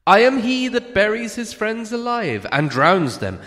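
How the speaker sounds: frightened hobbit flattering the dragon, high and nervous. A narrator's reading. Bilbo voice.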